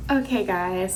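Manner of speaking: ordinary delivery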